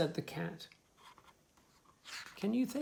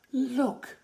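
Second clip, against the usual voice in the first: louder, higher exclaim; gasped look; 9 semitones higher, 10 dB louder than usual. Acted. Dog gasps at the puppies, louder and higher. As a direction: gasp out the word in surprise. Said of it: "gasped the dog".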